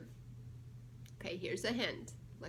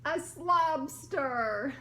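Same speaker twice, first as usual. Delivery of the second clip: slow reveal; 2 semitones higher, 6 dB louder, much slower than usual. Acted. Punchline reveal read aloud.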